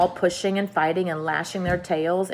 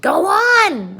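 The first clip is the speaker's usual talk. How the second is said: high, loud frantic call; frantic cry; 13 semitones higher, 8 dB louder than usual. A narrator's reading. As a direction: cry out for him to go on. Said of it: "they cried frantically".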